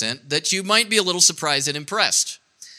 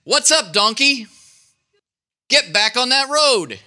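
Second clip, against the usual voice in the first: higher-pitched mock scolding; annoyed donkey driver; 7 semitones higher than usual. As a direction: call out the donkey, lightly cross. Balaam scolds his donkey.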